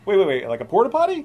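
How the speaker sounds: high surprised question. Real, not acted. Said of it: "stunned question".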